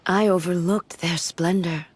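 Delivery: ordinary talk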